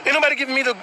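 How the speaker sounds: ordinary delivery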